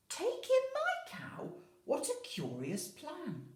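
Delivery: put-on old lady stunned by the advice; astonished old lady. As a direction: squeak it out in disbelief. Old lady's astonished reply.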